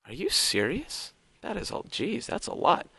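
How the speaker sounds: hushed, almost breathless astonishment; stunned disbelief. Real, not acted. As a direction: trail off into disbelief at the size. Shocked at huge number.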